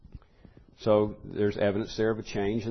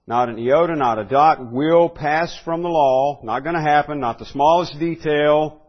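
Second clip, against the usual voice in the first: emphatic sermon declamation; forceful preaching; 4 semitones higher, 8 dB louder than usual. Acted. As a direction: drive each "not" home from the pulpit. Preacher hammering the point.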